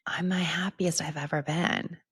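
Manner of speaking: whispered confession. Real, not acted. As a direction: breathe it out as a secret. Confession after tears.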